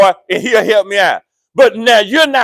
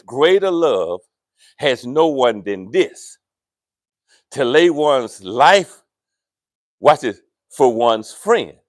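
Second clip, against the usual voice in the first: scripture read slow and deliberate; 8 semitones lower, slower than usual. Shown as a demonstration. Pastor rereading verse for church, slow. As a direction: lay every phrase down slowly. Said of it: "I want you to read that slow".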